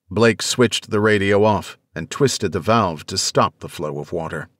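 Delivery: plain reading voice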